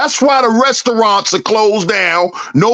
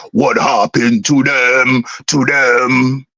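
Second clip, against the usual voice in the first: much lower Jamaican dancehall deejay voice; 9 semitones lower than usual. Retold or imitated. Mocking everyone's failed hustles in a much lower Jamaican deejay voice. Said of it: "In my bounty killer voice".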